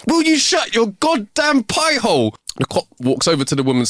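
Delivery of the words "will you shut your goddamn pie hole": high, drawn-out exasperated outburst; angry outburst. Acted. Husband finally snaps at his nagging wife, angry outburst. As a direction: explode at her, slow and high-pitched. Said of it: "The man explodes with anger".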